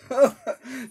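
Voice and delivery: loud mocking laugh. Real, not acted. Reader to the guides about free will, loud mocking laugh. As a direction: burst of loud scornful laughter.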